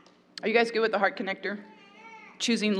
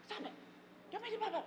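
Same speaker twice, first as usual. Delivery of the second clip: heady scolding; 11 semitones higher, 16 dB quieter, faster than usual. Retold or imitated. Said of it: "That's so heady".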